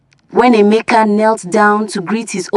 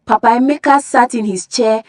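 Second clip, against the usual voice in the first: ordinary delivery, nothing marked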